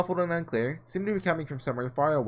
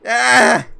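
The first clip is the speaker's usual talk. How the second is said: sudden high, loud cry of anguish; anguished scream; 8 semitones higher, 13 dB louder than usual. Acted. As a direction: let out a raw scream. Breakdown before the painting.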